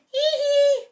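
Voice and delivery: a high cheeky piggy giggle. Acted. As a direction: giggle right in his ear. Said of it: "Pig went right up to Dog and went".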